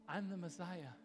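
low, soft, weighty confession; quiet revelation. Retold or imitated. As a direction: reveal it softly after a long pause. Jesus reveals his secret.

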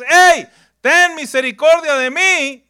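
loud, high desperate shout. Acted. Leper shouting to Jesus from afar, loud and high. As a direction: yell across the road for mercy. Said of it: "como le gritó a Jesús".

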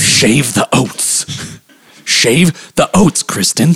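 slow, drawn-out command; mock stern father. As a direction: order it word by word as the oat-farm dad. Co-host plays the stern father.

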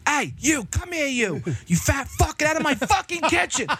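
high-pitched mock kitchen tirade; hammed-up furious chef; Gordon Ramsay yelling. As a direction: imitate a chef throwing a cook out. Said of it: "he's yelling at him".

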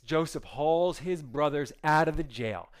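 ordinary talk

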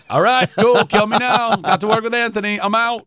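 high and loud, gushing in mock rapture; mock starstruck glee. Actor retelling meeting Anthony Hopkins, hammed-up giddy delight. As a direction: play the giddy fan who could die happy.

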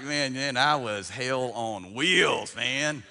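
loud, stretched-out brag; swaggering mock testimony; boastful testimony giver. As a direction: play up a wild past, drawing out the words. Acts out wild-past testimony givers.

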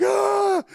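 high, loud comic bawl; hammed-up wordless wail of grief; mock crying wail. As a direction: bawl out one long, high note. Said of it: "I'm crying".

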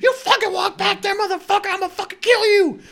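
very high, very loud acted screaming; retold enraged scream. Threatening a guy walking down, retold enraged scream. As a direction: explode at him, re-enacting a death threat.

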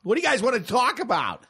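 hammed-up clueless comic shouting; shouting out things. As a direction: holler it at an imaginary crowd. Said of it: "Just shouting out things".

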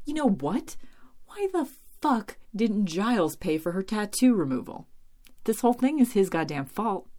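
fed-up and emphatic; exasperated rant. Real, not acted. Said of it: "piss me the fuck off".

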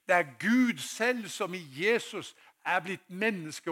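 loud, high preaching voice; forkynnelse for full kraft. Acted. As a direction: proclaim it to the whole hall, drawing out the key words. (kraftfull forkynnerstemme)

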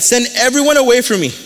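high-pitched, emphatic retold command; called out. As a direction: act out calling for the room to clear. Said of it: "he called out and said".

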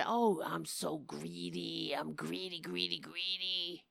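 deep, quiet mock "I'm so greedy"; mock Trump boast. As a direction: murmur it low like a smug caricature. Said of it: "he was boasting about".

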